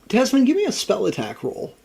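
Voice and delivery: high, loud name call; excited call. Real, not acted. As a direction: call his name with a jump up. GM calls player excitedly.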